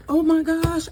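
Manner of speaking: high, loud thrilled exclamation; excited. Real, not acted. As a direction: burst out in delight. First look inside homemade loaf.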